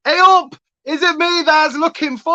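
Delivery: high, loud Yorkshire greeting; put-on Yorkshire. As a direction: ham up a Yorkshire lass.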